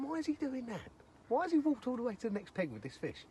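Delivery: high-pitched puzzled inner voice; baffled. Retold or imitated. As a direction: wonder in a high, incredulous voice. Retelling his baffled thoughts.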